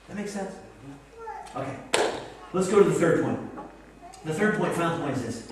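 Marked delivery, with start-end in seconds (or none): none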